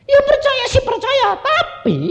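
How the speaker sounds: hammed-up squealed childish protest; whining. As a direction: whine very high, holding out the last word. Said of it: "pokoknya ngerengek terus".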